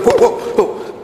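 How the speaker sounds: breathless startled whoa. Retold or imitated. Priest startled when his scapular moves, breathless whoa. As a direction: jump at the scapular moving under his shirt.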